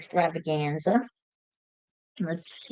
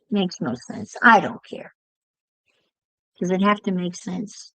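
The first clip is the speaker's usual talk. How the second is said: ordinary talk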